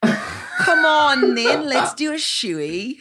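slow, high, hammed-up Australian accent; mock Australian accent. Showing off her own Aussie accent to her co-host. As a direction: drawl it out in an over-the-top Aussie voice. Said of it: "I'm the expert on it, on really good accents".

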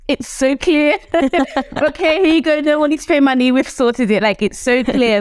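breezy pretend therapist; mock therapist. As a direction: play a smug therapist solving it instantly. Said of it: "I would be like".

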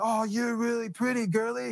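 put-on high, taunting drunk creep; creepy drunk. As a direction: leer at her like a menacing drunk. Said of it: "He's just walking down the line".

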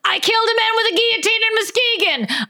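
high, fast rattled-off line; mock declamation. Host clowning after a pronunciation query, high fast declamation. Spoofs a vocal warm-up line.

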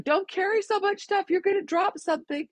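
a high mock-scolding husband; husband's warning. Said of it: "my husband's always like".

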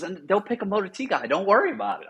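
imitated sergeant brushing off a worry; imitating platoon sergeant. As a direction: lift the pitch, playing the confident sergeant. Platoon sergeant reassuring him, acted.

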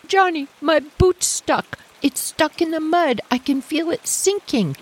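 put-on high, worried kid calling for help; a little sister stuck in the mud, begging her brother; panicky little girl. Calling out high and worried to her brother.

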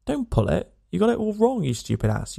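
high, strained outburst that drops on the insult; "got" hit hard, then a sneering "you stupid ass"; exasperated shout. Acted. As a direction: yell at him across the tent. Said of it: "you shout".

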